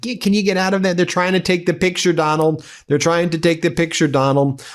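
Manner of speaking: high, pleading mock voice; exasperated Gianni. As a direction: beg Donald to move out of the shot. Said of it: "And Gianni's like".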